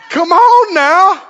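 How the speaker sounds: high, slow laughing plea. Real, not acted. Scene: preacher laughing at the silent congregation, coaxing. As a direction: laugh and coax them.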